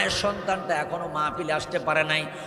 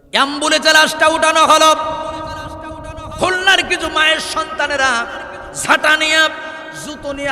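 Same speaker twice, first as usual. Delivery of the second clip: high, very loud declaimed narration; full-force preaching; 9 semitones higher, 9 dB louder than usual. Real, not acted. Preacher telling how the body was moved, full-force preaching. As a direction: proclaim it to the whole crowd.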